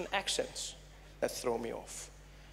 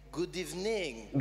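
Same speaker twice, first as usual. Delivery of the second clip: put-on slow "good evening" in a French accent; slower than usual. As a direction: say it as the French would, drawn out. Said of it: "Or as the French say".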